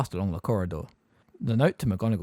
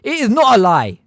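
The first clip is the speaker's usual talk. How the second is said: loud, much higher outburst; boy defying his teacher loudly; loud protest; 12 semitones higher, 12 dB louder than usual. A narrator's reading. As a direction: shout it back at her. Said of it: "said Harry".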